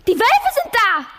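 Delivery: high, loud cry of "Wölfe!"; shrill alarm at the wolves; panicked cry. Acted. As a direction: cry it out as a terrified mother on the stairs. Terrified mother announces wolves.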